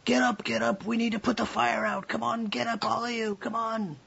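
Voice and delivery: high, urgent tavern keeper rousing everyone; yelling. A narrator's reading. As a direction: yell to get them all out of bed. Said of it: "the tavern keep is yelling".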